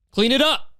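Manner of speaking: very high, sharp command; shrill order. Acted. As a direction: snap the order like a strict teacher. Mock teacher scolding.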